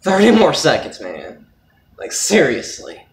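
strained, loud, effortful voice; holding a plank. Real, not acted. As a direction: push the words out while straining. Straining through a five minute plank.

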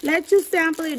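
high, light retelling of the seller; a hint of the sticker seller's sweet voice; lightly voiced seller. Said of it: "She's like".

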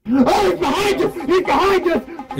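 high-pitched panicked warning; alarmed cry. Real, not acted. Spots monster behind the group.

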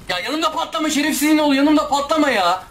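high, rapid exasperated protest; angry outburst. Real, not acted. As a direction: protest in a high, urgent rush. Enemy explodes beside the player. (tiz sesle sinirli çıkışma)

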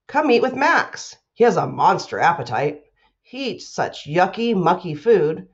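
put-on lively picture-book reading for kids; reading to children. A narrator's reading. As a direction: read it to a class like a playful storyteller. Teacher reads picture book aloud.

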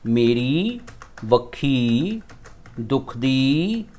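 word by word, with "दुखदी" drawn out very slowly; slow dictation. Shown as a demonstration. Teacher dictating slowly so the students can copy it. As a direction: dictate each word with a pause for writing. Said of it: "इस तरीके से".

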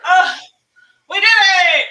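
loud relieved cheer; excited. Real, not acted. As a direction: shout it out, finally done. Finished hard challenge.

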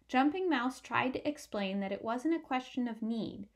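plain reading voice

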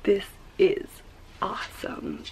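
soft amazed whisper. Real, not acted. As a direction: whisper it, barely breathing the words. Filming quietly in low light.

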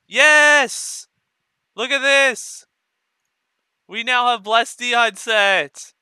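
high, loud victory yell; triumphant shouting. Real, not acted. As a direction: yell out your win. Celebrating looted set.

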